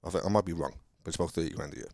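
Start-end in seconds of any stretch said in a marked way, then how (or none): none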